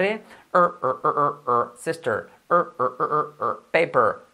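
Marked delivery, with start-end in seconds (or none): 0.0-1.7 drawn-out English R sound "er, er"; R drill. Shown as a demonstration. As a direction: hold the curled R again and again. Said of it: "la R". (la R inglesa repetida)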